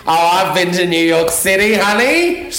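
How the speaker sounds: showy, rising name-drop; mock bragging. Guest showing off being well travelled, mock boastful. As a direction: climb high on the final word, hammed-up. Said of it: "Did you like how I just dropped in".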